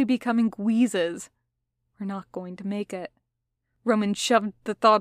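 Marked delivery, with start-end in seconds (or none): 2.0-3.1 soft, sinking admission; hushed despair. A narrator's reading. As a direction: say it quietly, as a private dread. Despairing thought while fleeing the hunt.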